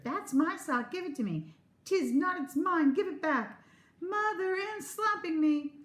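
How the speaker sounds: put-on high, loud squabbling little girl; quarreling child. Twin sister quarrels over a sock and calls her mother, high and loud. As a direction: fight over the sock, then yell for mother.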